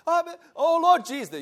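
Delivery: high shouted call of Lord Jesus; fervent high shouting call. Retold or imitated. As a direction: cry it out like the church elders. Child imitating the calling.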